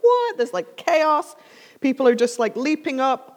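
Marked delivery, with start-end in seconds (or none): 0.0-0.4 high-pitched shocked cry; crowd's startled reaction; acted disbelief. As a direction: blurt it out high, stunned. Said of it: "Everybody's like".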